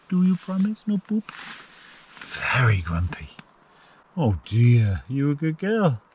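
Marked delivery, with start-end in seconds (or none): 0.1-1.3 playful high dog talk. Real, not acted. 1.3-3.3 grumpy dog voice. Pretend.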